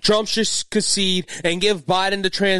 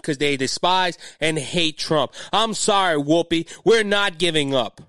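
usual speaking voice

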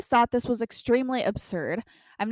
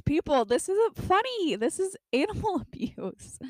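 laughing through it; 8 semitones higher than usual. Real, not acted. Reading a comment, laughing.

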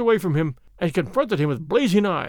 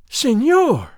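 high, much louder shriek; 6 semitones higher, 8 dB louder than usual. A narrator's reading. Insulted señorita screaming at the captain. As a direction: scream his title in outrage. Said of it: "she shrieked".

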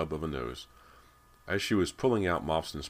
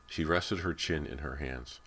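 ordinary delivery

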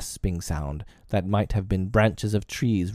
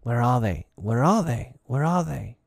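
higher, mildly savage calls; savage echoing cry; 4 semitones higher than usual. A narrator's reading. Echoes of the pirates' gun hunt the children, savage cry. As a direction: call it out three times, rising in pitch. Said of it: "echoes seemed to cry savagely".

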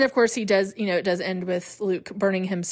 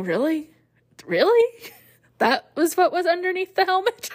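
higher, rising in disbelief, second really highest; mock disbelief; 9 semitones higher than usual. Seeing Vader unmasked, mock disappointed disbelief. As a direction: ham up the letdown in rising questions. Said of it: "and thinking".

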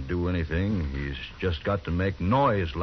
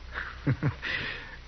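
scornful laugh; 6 semitones higher, 7 dB quieter than usual. Acted. Bissell refuses to hand guns.

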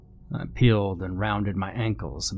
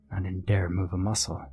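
plain reading voice

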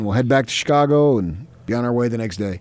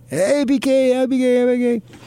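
fans calling out; 13 semitones higher than usual. Retold or imitated. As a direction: hail him like an eager fan. Fans calling out across shop.

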